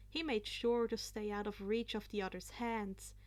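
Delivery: ordinary delivery, nothing marked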